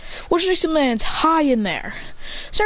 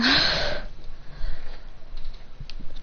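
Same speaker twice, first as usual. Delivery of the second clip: soft, heavy acted panting; exhausted panting; 3 semitones higher, 6 dB quieter than usual. Fighter lands worn out by battle, exhausted panting. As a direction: pant it out, spent from the fight. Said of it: "panting the shit out of himself".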